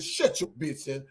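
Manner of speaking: low, soft threat; Angelo's menacing voice. Retold or imitated. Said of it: "he coulda said".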